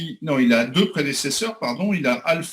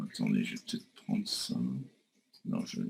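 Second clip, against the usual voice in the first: talking to himself; 12 dB quieter, faster than usual. Real, not acted. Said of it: "je parle tout seul".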